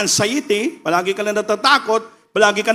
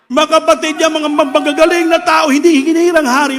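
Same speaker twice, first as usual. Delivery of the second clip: rising, impassioned sermon voice, higher and louder; preaching at full force; 8 semitones higher, 4 dB louder than usual. Acted. Preacher to the congregation, praising David's humble heart, impassioned build. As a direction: build the fervour line by line toward the climax.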